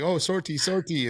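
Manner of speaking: high-pitched "sortie" with a hard American r; "sortie" in mock discovery, blatantly American; very Americanized. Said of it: "we say it very Americanized".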